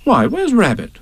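high, lilting motherly voice; Kanga. Acted. Kanga looking round for Rabbit, motherly and high. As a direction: lift it high like a fussing mother.

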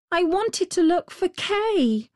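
put-on dismayed little girl. A narrator's reading. Gerda recalls her lost playmate Kay, dismayed. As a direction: lift it high like an upset child.

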